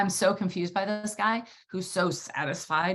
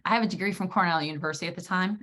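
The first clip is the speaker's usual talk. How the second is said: ordinary talk, nothing marked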